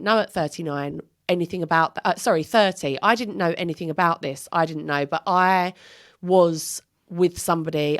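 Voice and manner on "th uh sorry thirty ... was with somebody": ordinary delivery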